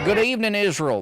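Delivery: loud, high rally greeting; hammed-up stage greeting; Lindsey Graham impression. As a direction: hail the crowd like a Southern politician. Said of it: "He's like".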